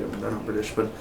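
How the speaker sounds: normal talking voice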